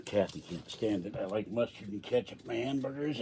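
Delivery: ordinary delivery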